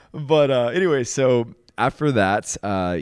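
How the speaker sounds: ordinary talk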